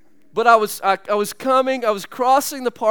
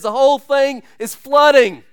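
alarmed report; 6 semitones higher, 7 dB louder than usual. Retold or imitated. Friend bursts in alarmed.

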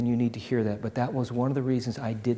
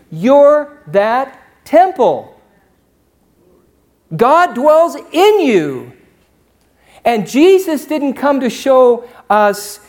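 high, loud, slow ringing proclamation; preaching at full force; 11 semitones higher, 13 dB louder than usual. Acted. Pastor declaring God dwells in them, loud ringing preaching. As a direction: proclaim it to the rafters.

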